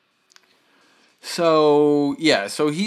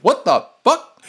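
shocked; 11 semitones higher, 5 dB louder than usual. Retold or imitated. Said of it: "I literally was like".

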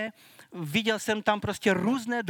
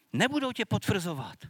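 usual speaking voice

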